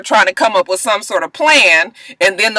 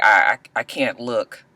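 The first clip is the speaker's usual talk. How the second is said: low, faltering, softened voice; hushed, low stammer; shaken; 10 semitones lower, 7 dB quieter than usual. Retold or imitated. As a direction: falter quietly, as if unable to face it. Acting out Asher unable to look.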